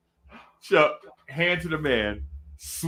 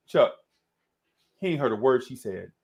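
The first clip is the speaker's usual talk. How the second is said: ordinary delivery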